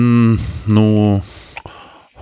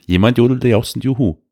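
schnell; 3 semitones higher, much faster than usual. Shown as a demonstration. Repeats sentence fast.

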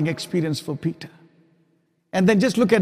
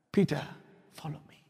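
hushed call; 10 dB quieter, faster than usual. Acted. Jesus calls Peter, hushed.

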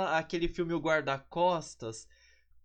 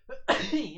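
sharp real sneeze; a loud sudden sneeze; sneezing; 6 semitones higher, 6 dB louder than usual. Real, not acted. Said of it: "desculpem ter espirrado".

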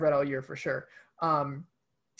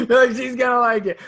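laughing; 7 semitones higher, 7 dB louder than usual. Real, not acted. Laughing while repeating co-host's joke.

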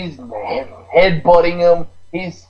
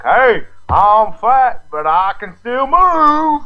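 fat bear boast; 6 semitones higher, slower than usual. Retold or imitated.

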